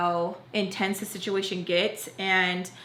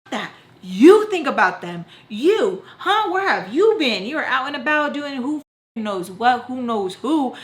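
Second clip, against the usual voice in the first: loud, higher-pitched re-enacted confrontation; angry retort; 4 semitones higher, 6 dB louder than usual. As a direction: throw the accusation back at mom, punching YOU. Acting out herself yelling at mom.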